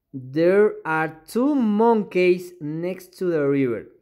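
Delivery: slow, careful dictation of an English sentence; slow classroom read-aloud. Shown as a demonstration. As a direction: spell it out word by word for learners. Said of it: "teacher reads example slowly".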